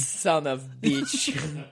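put-on Russian accent. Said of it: "That's your favorite Russian".

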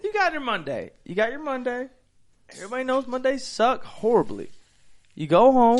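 high, punchy hype-man storytelling; preaching. Acted. Host preaching a beer shotgun to listeners, high-pitched preaching. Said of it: "my preaching of a shot".

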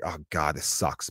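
mock dejected. Driver seeing the backed-up mall traffic, mock dejected. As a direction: sink down in resignation.

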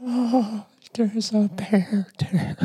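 put-on high, slow old woman voice. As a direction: play a sweet old lady. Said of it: "do an old woman voice".

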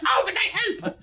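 very high, squeaky plea; small creature protesting. Acted. Bido protesting to Sky, squeaky and hurt. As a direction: whine that you were helping.

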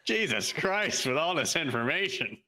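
hammed-up lecturer's exasperation; mock exasperated professor. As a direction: draw out the oath like an overwhelmed lecturer. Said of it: "I'm sure professors say that a lot in lectures".

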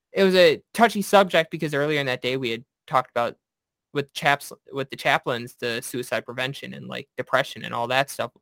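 ordinary talk, nothing marked

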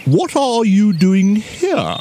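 high, slow, indignant owl; stretched-out cross question in an owl voice; cross old owl. A narrator's reading. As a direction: draw out a cross owl's demand. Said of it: "seemed to be quite cross".

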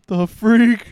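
high, loud baffled exclamation; stunned. Retold or imitated. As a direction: blurt it out in disbelief. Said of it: "and then I was like".

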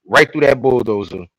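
normal talking voice

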